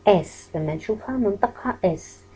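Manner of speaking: ordinary delivery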